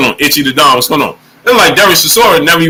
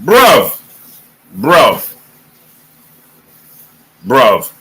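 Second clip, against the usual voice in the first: fading bruv; 6 semitones lower, much slower than usual. Real, not acted. Streamer dismayed about Chisora, dropping each time. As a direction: sink further with every bruv.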